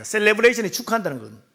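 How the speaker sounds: ordinary talk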